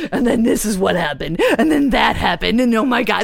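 loud, agitated nightmare recap; worked-up retelling. Acted. Someone telling friends their nightmare, loud and worked up. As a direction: act out breathlessly reporting a bad dream.

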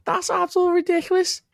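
squeaky high mock Peaky Blinders voice, laughing through it; high-pitched parody gangster voice cracking with laughter; mock Tommy Shelby. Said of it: "I'm Tommy Shelby".

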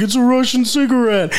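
high-pitched mock enthusiasm; hyped-up retold cop pitch; imitated excited cop. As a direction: show it off like a prize. Acting cop showing off the carton.

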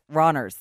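"Runners" with a flat Dublin vowel, like "ronners"; Dublin pronunciation. Retold or imitated. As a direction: say "Runners" as "rahn-ers", imitating the mate. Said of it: "the accent is quite dub".

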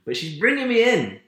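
high, loud exasperated outburst. Real, not acted. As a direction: burst out in frustration. Frustrated complaint about boss.

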